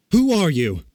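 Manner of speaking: loud, high challenge; crying out. A narrator's reading. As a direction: shout it at the intruder. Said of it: "and cried out".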